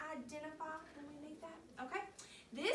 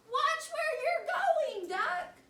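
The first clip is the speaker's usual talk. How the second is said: much higher, louder, slow squawking hen; cross chicken voice; 13 semitones higher, 10 dB louder, slower than usual. Acted. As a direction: play the annoyed hen, loud and high. Chicken's thought read in voice.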